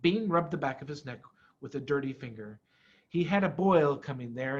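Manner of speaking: plain reading voice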